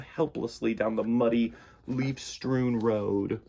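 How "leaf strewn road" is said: ordinary delivery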